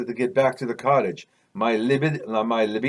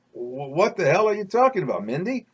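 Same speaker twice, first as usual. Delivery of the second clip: higher-pitched, stammered disbelief; baffled question lifting on the name; bewildered; 5 semitones higher than usual. Acted. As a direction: ask it taken aback, pitch climbing. Narrator's startled reply in story.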